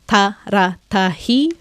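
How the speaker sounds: Ta, ra, ta, hi as four separate beats; syllable by syllable. Shown as a demonstration. Teacher counting four syllables, separated beats on Ta, ra, ta, hi. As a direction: count out Ta, ra, ta, hi, pausing after each syllable. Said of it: "we count them".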